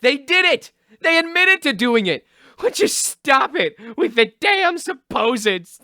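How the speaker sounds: high-pitched, exasperated outburst; angry outburst. Acted. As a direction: blow up at the colleague who keeps correcting you. Director snaps at interrupting spokesman.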